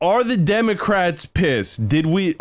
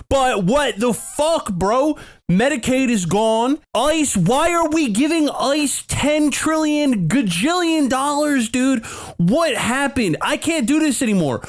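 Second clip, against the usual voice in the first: exasperated rant; 10 semitones higher than usual. Real, not acted. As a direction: vent the outrage, voice pitched up. Ranting about the bill.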